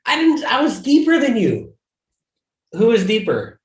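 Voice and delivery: high, loud playful denial; indignant protest. Real, not acted. Friendly argument over snaked wave.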